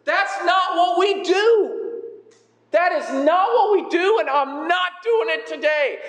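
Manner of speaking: hammed-up indignant objector digging in; stubborn church member. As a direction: whine a mock-outraged refusal up high. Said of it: "That's exactly where Peter is".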